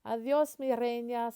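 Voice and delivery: grandparents calling out a loving goodbye. Acted. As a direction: call out to her from the yard. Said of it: "And they yell".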